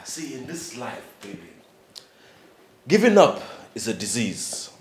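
ordinary delivery

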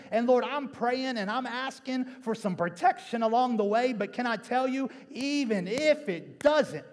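loud, high, impassioned preaching that keeps climbing; preaching at full force. Acted. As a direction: preach it hard to the congregation and build to a roar. Preacher building to full-force climax.

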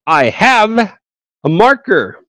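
I have a marker, muy despacio y marcado; ejemplo lento y claro. Shown as a demonstration. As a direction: dicta I have a marker palabra por palabra. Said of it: "Por ejemplo". (ejemplo dictado muy despacio)

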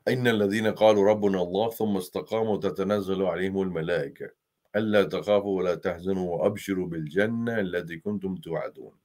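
fast, flowing recited Arabic; Quran recitation. Acted. Preacher quotes Surah Fussilat, rapid flowing recitation. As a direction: recite the verse swiftly from memory.

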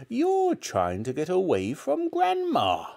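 high, slow, drawn-out accusation; menacing grandmother. A narrator's reading. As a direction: draw it out as a threatening granny.

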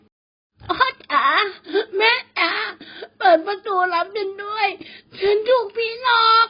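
put-on a terrified boy wailing at his door; tearful panicked shouting; crying out for parents. A narrator's reading. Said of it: "ตะโกนเรียกพ่อแม่เสียงดังสนั่น".

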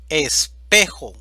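Espejo lento y fuerte, golpe en PE; ejemplo enfatizado. Shown as a demonstration. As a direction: alarga y marca fuerte la sílaba PE de Espejo. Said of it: "esta palabra es una palabra grave". (Espejo, lento y con fuerza en PE)

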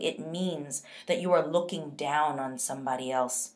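normal talking voice

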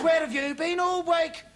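slow, high-pitched interrogation; demanding boss. Acted.

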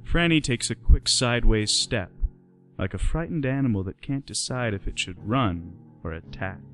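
ordinary delivery

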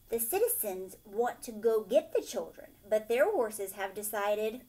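ordinary delivery, nothing marked

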